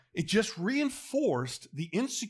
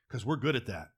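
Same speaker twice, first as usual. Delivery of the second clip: usual speaking voice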